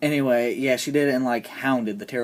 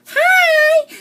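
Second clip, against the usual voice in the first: tiny line in a very high, loud squeal; exaggerated high-pitched imitation; mock Sophia voice; 6 dB louder than usual. Reviewer mocking Sophia's two lines, very high put-on voice.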